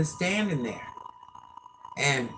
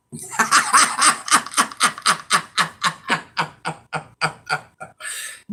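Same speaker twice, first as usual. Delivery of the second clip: a long rolling real laugh; 3 semitones higher, 4 dB louder than usual. Real, not acted. Woman laughing at saying powder instead of power, long laugh. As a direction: laugh hard at your own slip.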